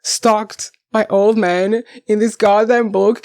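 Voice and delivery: usual speaking voice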